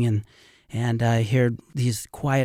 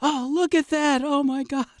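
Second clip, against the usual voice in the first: much higher, delighted gushing in a put-on voice; imitating awestruck old man. Guide imitating elderly rafter marveling at the canyon, awestruck. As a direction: play an elderly rafter overcome with wonder. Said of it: "Jim, you okay?".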